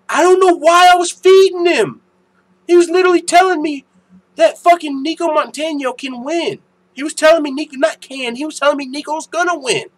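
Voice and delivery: loud, high, heated complaint; exasperated rant. Real, not acted. Complaining to viewers about a stubborn chat troll, loud and heated. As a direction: vent hard about the troll.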